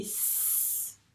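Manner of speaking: s, juste le souffle sifflant, sans voix; son s soufflé. Shown as a demonstration. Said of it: "elle fait quel son cette lettre C". (maîtresse fait entendre le son s)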